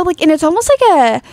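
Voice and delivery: usual speaking voice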